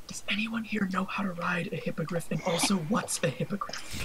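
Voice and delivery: hushed stage whisper; soft, conspiratorial aside; loud whisper. Acted. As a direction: whisper it to the one beside you. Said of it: "I whisper kinda loudly".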